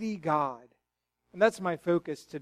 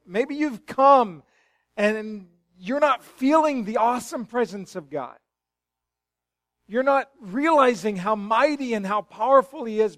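preaching; 5 semitones higher, 7 dB louder than usual. Acted. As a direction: press the point on the congregation. Preacher builds to full force.

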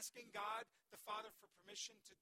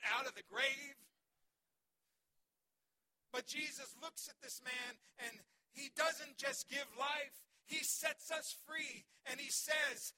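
shouted preaching; 5 semitones higher, 12 dB louder than usual. Acted. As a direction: shout the freedom over the crowd. Preaching Jesus freeing Lazarus.

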